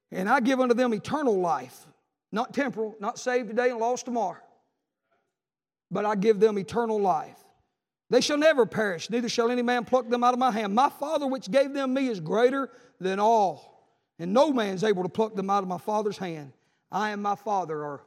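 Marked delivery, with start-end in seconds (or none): 0.1-1.9 loud, emphatic scripture reading; declaimed scripture. Real, not acted. Preacher reading Jesus' promise to congregation, declaimed scripture. 5.9-18.0 loud, emphatic scripture reading; declaimed scripture. Real, not acted. Preacher reading Jesus' promise to congregation, declaimed scripture.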